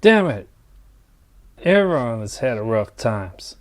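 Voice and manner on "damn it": loud, high, snapping out the curse; angry curse. Acted. Mike cursing at the AI's suggestion, angry. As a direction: blurt it in irritation. AI questions his brother's trauma.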